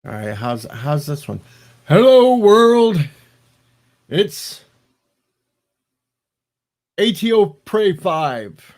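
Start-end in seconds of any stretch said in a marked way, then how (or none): 1.9-8.7 high, loud mock show intro; big, high-pitched greeting with drawn-out words; put-on shouted greeting. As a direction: belt out a hammy hello to the world. Trying a voice to cheer friend.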